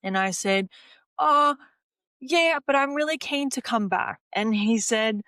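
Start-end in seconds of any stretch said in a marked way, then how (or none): 1.2-4.2 higher, eager insistence; brightly pleading to return; keen swimmer pleading with coach, higher and eager; eager protest. Retold or imitated.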